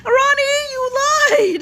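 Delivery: high, wailing playful accusation; comic high-pitched outrage; mock accusation. Real, not acted. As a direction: cry out betrayed, half laughing. Mock accusing viewer of bad tip.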